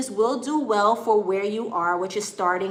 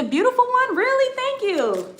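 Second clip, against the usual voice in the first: delighted surprise; 6 dB louder than usual. Real, not acted. Viewer compliments her American accent.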